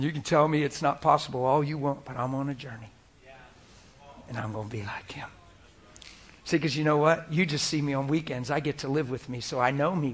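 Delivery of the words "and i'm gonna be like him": breathy whisper, much softer and lower; awed whisper. Real, not acted. Preacher confiding his love for Jesus, whispering. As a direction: whisper it in wonder. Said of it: "whispered resolve".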